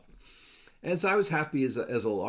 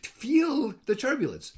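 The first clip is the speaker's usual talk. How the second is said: high, slow, worried; alarmed wife; 11 semitones higher, 4 dB louder, slower than usual. Retold or imitated. Wife warns her sleeping husband of turbulence, high and slow. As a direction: draw it out, pitched up with alarm.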